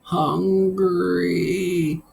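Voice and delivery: high, far-off hungry moan. Acted. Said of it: "you moaned".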